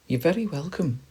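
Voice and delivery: high squirrel voice; friendly squirrel. Acted. Squirrel accepting the friends' thanks, high and friendly. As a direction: answer politely, pitched right up.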